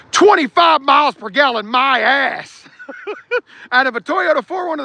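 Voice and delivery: high, loud mocking disbelief; scoffing. Real, not acted. Mocking the V8 mileage claim to chat, loud scoff.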